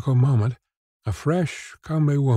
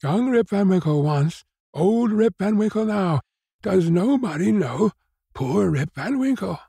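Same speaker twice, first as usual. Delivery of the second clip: high-pitched impassioned appeal; emotional high cry to the crowd; 9 semitones higher than usual. A narrator's reading. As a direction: plead to be recognized, pitched high. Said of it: "cried he".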